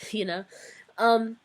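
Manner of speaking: ordinary talk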